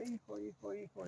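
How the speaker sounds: high, very soft mock call; mock mother's cry. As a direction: imitate a distant-sounding mom's cry, quietly. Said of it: "la mamá grita". (grito fingido de mamá, agudo y bajito)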